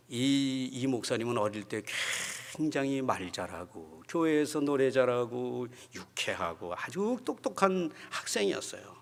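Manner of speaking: usual speaking voice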